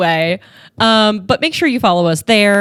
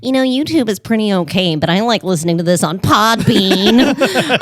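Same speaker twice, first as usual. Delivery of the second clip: nerd voice. Pretend. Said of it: "that's my nerd voice".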